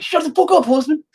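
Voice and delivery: very high imitation of a cross New York wife; mad New York accent. Wife telling husband off, imitated in high New York accent. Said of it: "my wife has that accent when she gets mad at me".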